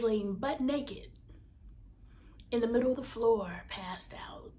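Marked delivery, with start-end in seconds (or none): 3.7-4.5 breathy whisper, dropping off; passed out whispered almost to nothing. Retold or imitated. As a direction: hush it like a secret. Drops to a hush.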